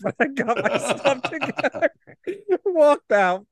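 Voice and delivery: talking through laughter; laughing. Real, not acted. Laughing while telling.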